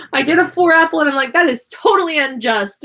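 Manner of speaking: laughing mock outrage; high, fast, laughing indignation. Real, not acted.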